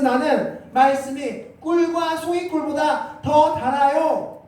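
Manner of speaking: high, emphatic confession; David. Acted. King confessing the word is sweet, lifted confession. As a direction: declare it with a lifted, stretched delivery. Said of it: "라고 고백하고 있는 겁니다".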